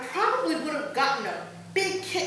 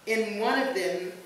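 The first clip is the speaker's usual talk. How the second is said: normal talking voice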